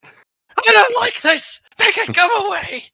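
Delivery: very high panicked squeaky voice; panicked squeal. Acted. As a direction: shriek it in disgusted panic. Skull panicking, very high pitched.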